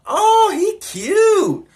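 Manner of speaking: very high, loud, drawn-out squeal; imitated girl's squeal. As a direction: squeal it like the admiring girl in back. Back-seat girl leans forward.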